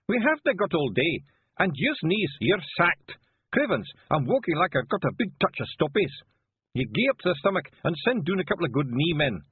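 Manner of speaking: high, loud Scottish voice, slowed right down; a gruff little Feegle in disguise barking orders; high Scots wee man. Acted. Feegle passenger voice.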